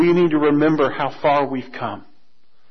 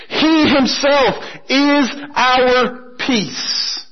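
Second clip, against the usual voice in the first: very slow, each word weighted; solemn slow refrain; 6 semitones higher, much slower than usual. Acted. Preacher repeating the key verse, very slow and solemn. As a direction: let the verse land slowly.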